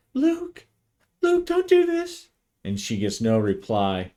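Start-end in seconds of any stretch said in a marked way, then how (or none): 0.1-2.3 high, pleading cry into the radio; frightened woman calling over the radio; desperate plea. Acted. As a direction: beg him to stop, voice pitched way up.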